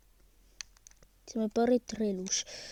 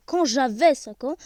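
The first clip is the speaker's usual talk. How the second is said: usual speaking voice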